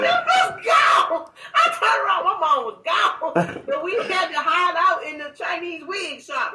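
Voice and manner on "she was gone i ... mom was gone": high, loud laughing exclamation; laughing shout. Real, not acted. Telling friend her mom ran off, laughing shout.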